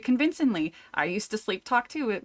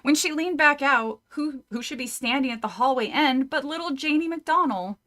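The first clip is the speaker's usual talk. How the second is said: ordinary delivery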